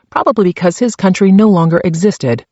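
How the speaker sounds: usual speaking voice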